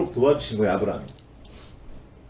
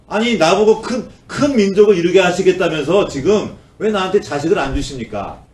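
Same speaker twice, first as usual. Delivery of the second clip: higher, louder sarcastic protest to God; sarcastic complaint; 6 semitones higher, 5 dB louder than usual. Acted. Abraham needling God over the missing son, sarcastic. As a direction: throw God's promise back at Him, needling. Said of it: "비아냥거리는 거예요".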